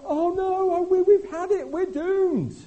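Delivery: very high, loud hammed-up panic; mock panic. Preacher parodies newspaper readers panicking, high and loud. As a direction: wail as if doom has come. Mocking doom-laden newspapers.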